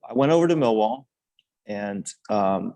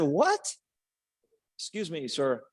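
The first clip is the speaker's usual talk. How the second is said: incredulous high-pitched reply, falling softer; retold disbelief; 5 semitones higher, 4 dB quieter, slower than usual. Player answering a famous manager's surprise call, incredulous. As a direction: ask it high, stunned and polite.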